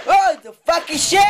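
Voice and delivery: loud, slow startled curse; startled shout. Real, not acted. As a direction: yell out in shock. Nearly falls in game.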